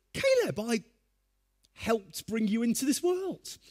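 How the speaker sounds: high indignant mock outrage; father scolding son over a hug, mock indignant and high; mock angry dad. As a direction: play the hurt, exasperated father. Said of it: "And I was really angry".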